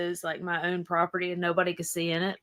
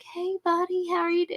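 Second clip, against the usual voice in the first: sweet pet greeting; 9 semitones higher than usual. Retold or imitated. Greeting the dog in trunk.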